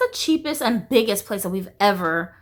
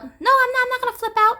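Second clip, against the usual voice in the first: higher-pitched indignant mom impression; mocking mom's denial; 14 semitones higher, 3 dB louder, faster than usual. Daughter mimicking her mom's defensive denial, high and indignant. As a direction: play mom insisting she won't flip out, pitched way up. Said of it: "watch you flip out".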